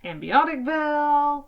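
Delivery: mangled, drawn-out Ambioticville; garbled town name. Real, not acted. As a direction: say Ambioticville with the name muddled and stretched. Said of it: "So I've called it".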